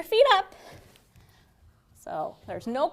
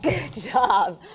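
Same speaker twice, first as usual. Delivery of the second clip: laughing; 3 semitones lower than usual. Real, not acted. Laughing after ribbits.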